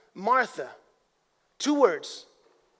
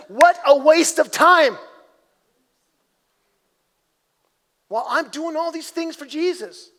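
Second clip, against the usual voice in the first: frustrated Martha; 4 semitones higher, 6 dB louder than usual. Retold or imitated. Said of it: "She's like".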